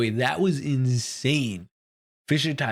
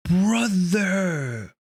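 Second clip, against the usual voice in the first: stunned exclamation; 5 semitones higher than usual. Real, not acted. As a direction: cry it out in shock, pitch sliding down. Anguished reaction to death scene.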